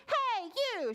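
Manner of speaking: high, challenging storybook call; high-pitched mock shout; bold shout. A narrator's reading. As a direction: call out the frog boldly. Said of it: "she shouted".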